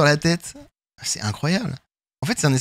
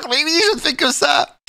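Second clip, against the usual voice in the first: strained high patient voice; 11 semitones higher than usual. Retold or imitated.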